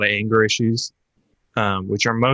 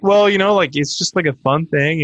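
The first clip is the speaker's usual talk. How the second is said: higher, louder mock excuse; put-on placating friend, pitched up; retold defensiveness; 6 semitones higher, 3 dB louder than usual. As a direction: lightly imitate friends making excuses. Retold friends defending the bubble wrap.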